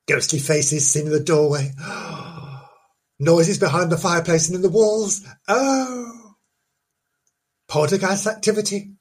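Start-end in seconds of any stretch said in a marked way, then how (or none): 1.8-2.6 soft, breathy theatrical gasp; a hammed-up horrified intake of breath; mock gasp. Mock fright at ghost faces. 5.5-6.4 high, wailing mock-scared oh; a hammed-up ghostly moan of fear. Mock fright at noises.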